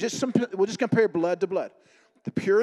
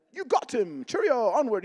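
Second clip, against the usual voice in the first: high, jaunty mock-British cheer; British airman; 6 semitones higher, slower than usual. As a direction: send him off in a hammed-up British accent. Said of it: "The British during the World War Two".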